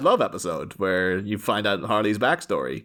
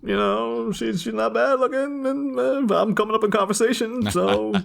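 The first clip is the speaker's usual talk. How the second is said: Batman's hopeful musing; 10 semitones higher, slower than usual. Acted. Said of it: "He's just like".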